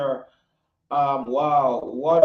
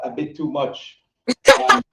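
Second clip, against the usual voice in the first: normal talking voice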